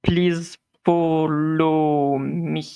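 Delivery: slow robotic monotone, each syllable separate; metallic voice. Acted. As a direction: drone voice, no intonation, syllables split. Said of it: "in a kind of metallic voice that's clearly, it doesn't have any form of intonation".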